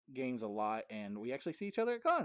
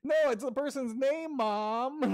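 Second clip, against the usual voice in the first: high, loud whining protest; mock whiny protest; 13 semitones higher, 9 dB louder, slower than usual. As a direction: whine it back at mom like a teen caught out. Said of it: "And he goes".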